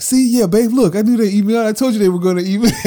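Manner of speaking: high, loud gloating, fast and laughing; excited. Retold or imitated. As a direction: rub it in, told you so. Gloating to his wife he was right.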